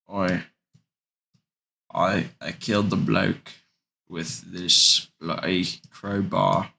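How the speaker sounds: lightly put-on British accent; a hint of a British tough guy, halting; Butcher impression. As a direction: say it as Butcher would, slowing at the end. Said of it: "that's how he says it".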